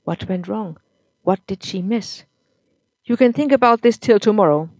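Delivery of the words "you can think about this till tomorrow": put-on loud, bitter drunk husband; drunk angry husband. A narrator's reading. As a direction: spit it out at the wife, louder.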